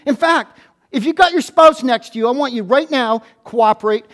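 ordinary talk, nothing marked

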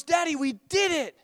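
put-on high-pitched, proud little-boy voice; beaming child. Son strapped in car telling dad, beaming. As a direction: imitate a kid glowing with triumph. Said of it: "with this beaming, glowing face".

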